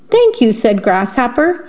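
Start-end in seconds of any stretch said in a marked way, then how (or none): 0.1-0.5 much higher, lilting thanks; high-pitched thanks. A narrator's reading. Grasshopper thanking the mosquito, high-pitched and lilting.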